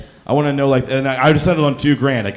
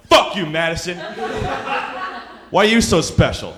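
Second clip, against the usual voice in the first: mock yell; 7 semitones higher than usual. Yelling at Madison on the TV screen, mock angry. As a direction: bark it at the TV, half laughing.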